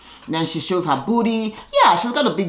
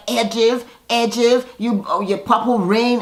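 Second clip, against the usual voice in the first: tipsy taunting; 6 semitones higher, slower than usual. Retold or imitated.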